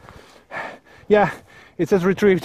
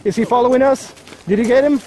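higher, tense voice under fire; tense and urgent; 6 semitones higher than usual. Real, not acted. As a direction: talk fast and worried in a fight. Tense firefight.

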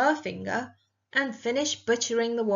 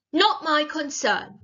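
higher, louder brush-off; dismissive witch; 5 semitones higher, 5 dB louder than usual. A narrator's reading. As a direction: refuse to be bothered.

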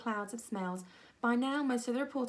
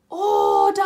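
very loud high command; shouted; 12 semitones higher, 16 dB louder than usual. A narrator's reading. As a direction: bellow it to silence the hall. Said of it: "shouted Missus Sanders".

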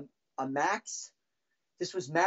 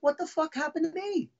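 much higher, indignant retold protest; hurt disbelief; 10 semitones higher than usual. Drummer replaced before forty thousand people, hurt disbelief. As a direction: plead in wounded bewilderment.

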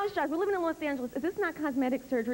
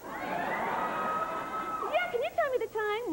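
put-on girly squeak; much slower than usual. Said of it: "It's like".

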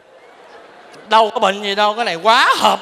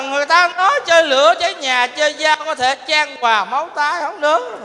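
normal talking voice

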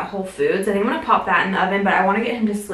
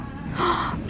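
excited gasp; 9 semitones higher, 8 dB quieter than usual. Real, not acted. Spotting the bath toys.

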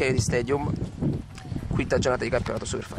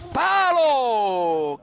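shouted; 11 semitones higher, 5 dB louder than usual. Acted. Commentator shouts hit post.

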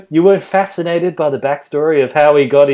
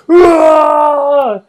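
high, loud anguished yell; mock Wolverine roar; 13 semitones higher, 7 dB louder than usual. Wolverine roaring at the sky, high mock yell. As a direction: throw your arms up and cry out. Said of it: "throws his arms up and goes".

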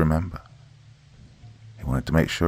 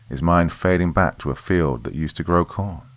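ordinary delivery

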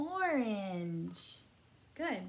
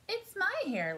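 high, loud, merry line with only a hint of laughter; mock laughing; 13 semitones higher, 8 dB louder than usual. A narrator's reading. Charlie answering whose hair it is, lightly mock laughing. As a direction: pitch it up, a touch of laugh. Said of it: "laughed Charlie".

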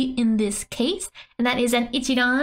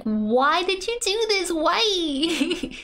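high, wailing "why me" complaint; mock protest; 7 semitones higher, slower than usual. Translating the manga girl's nande, mock wailing protest. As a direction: plead in a hammed-up high voice. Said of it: "this nande is like".

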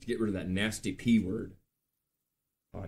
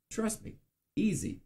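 lightly voiced cocky brag, pitched up; a hint of a show-off voice; cocky boast; 10 semitones higher, 4 dB quieter than usual. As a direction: toss it off like it's nothing. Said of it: "bragging in a put-on voice".

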